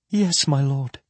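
tearful, choked reply; sobbing. A narrator's reading. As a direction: answer through sobs. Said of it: "Parasim sobbed".

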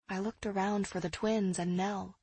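plain reading voice

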